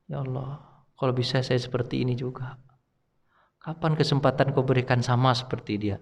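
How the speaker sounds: soft, subdued plea to God; quiet retold prayer. Man praying to be like the late professor, quiet plea. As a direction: murmur a wistful prayer, recalled.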